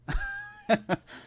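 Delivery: a bright burst of laughter, falling off; high laugh. Real, not acted. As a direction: laugh at your own bit. Laughs at own joke.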